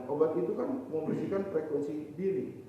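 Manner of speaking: usual speaking voice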